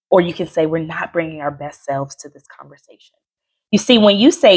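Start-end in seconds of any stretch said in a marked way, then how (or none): 0.7-3.2 soft calm line. Shown as a demonstration. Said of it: "tone is everything".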